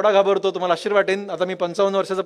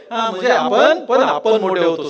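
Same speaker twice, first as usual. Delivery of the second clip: ordinary talk, nothing marked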